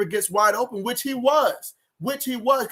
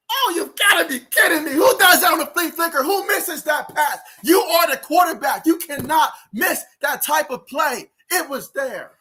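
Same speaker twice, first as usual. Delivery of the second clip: loud, high, anguished yelling at the screen; furious fan outburst; 6 semitones higher, 5 dB louder than usual. Real, not acted. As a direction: scream in disbelief at the missed throw, slowing down as it goes on. Watching his quarterback miss pass.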